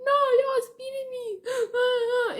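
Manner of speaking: high, loud tearful wail; a drunk girl wailing that she's being beaten; mock drunk wailing. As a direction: sob it out to the guards. Crying to guards.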